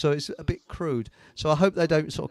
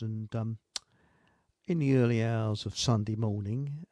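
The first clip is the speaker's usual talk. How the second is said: ordinary delivery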